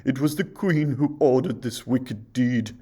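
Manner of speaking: loud, anguished plea; remorseful huntsman; tearful huntsman. A narrator's reading. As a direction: beg her forgiveness in tears. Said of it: "he sobbed".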